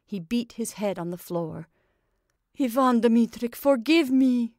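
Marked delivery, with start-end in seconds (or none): 2.6-4.5 loud, high cry for forgiveness; cried out. A narrator's reading. Makar beats his head on the floor, crying out for pardon. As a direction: cry out his name and beg. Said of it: "he cried".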